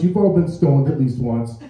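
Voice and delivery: usual speaking voice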